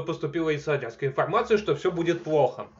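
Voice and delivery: usual speaking voice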